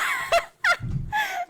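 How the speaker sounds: squealing laugh, very high; high shrieking laugh. Real, not acted. Laugh alone.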